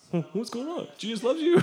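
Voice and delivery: small, high, soft voice; mock meek. Replying to a big yelling stranger, mock meek. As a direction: answer timidly, high and light.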